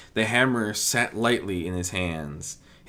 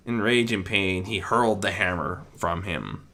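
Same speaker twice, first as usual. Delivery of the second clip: ordinary delivery, nothing marked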